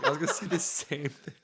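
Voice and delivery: said through laughter, pitch up; laughing. Real, not acted. Friend repeats his joke to the group, laughing. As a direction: laugh while repeating the line.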